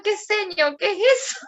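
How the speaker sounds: high, startled question; mock outrage at an unfamiliar word; incredulous mother, pitched high; shocked disbelief. Her shocked reaction retold.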